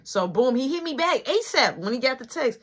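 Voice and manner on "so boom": usual speaking voice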